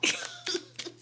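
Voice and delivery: soft breathy giggle; whispered giggle. Real, not acted. Reader embarrassed at being corny, whispered giggle. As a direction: laugh it off in a whisper.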